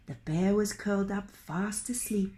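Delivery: usual speaking voice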